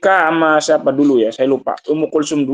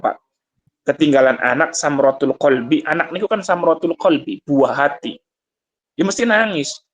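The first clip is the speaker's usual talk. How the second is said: usual speaking voice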